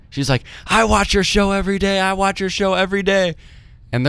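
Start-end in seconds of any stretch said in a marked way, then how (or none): 0.7-3.4 high-pitched, excited fan gushing; mock screaming fan. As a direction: squeal it like an overeager fan. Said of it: "just starts screaming".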